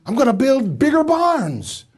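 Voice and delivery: hammed-up hoarder announcing big plans; greedy rich man. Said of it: "what was he thinking".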